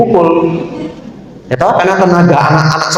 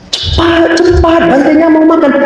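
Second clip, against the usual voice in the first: higher, pressing voice, rushing someone; urgent hurrying; 12 semitones higher than usual. Retold or imitated. Adults pressing the student photographer: higher urgent hurrying. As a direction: push the kid to hurry up, voice pitched up.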